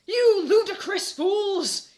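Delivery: hammed-up slow shout, high and loud. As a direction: roar it out like a melodramatic headmaster. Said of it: "he shouted".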